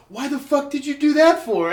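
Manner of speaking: high, loud, strained cry through laughter; imitated winded, laughing outburst; breathless laughing uncle. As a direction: demand it while cracking up. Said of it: "he can't catch his breath 'cause he's fucking laughing".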